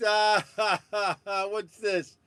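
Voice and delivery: ordinary delivery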